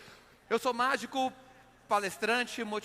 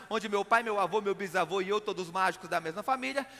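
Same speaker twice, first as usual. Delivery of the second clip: ordinary talk, nothing marked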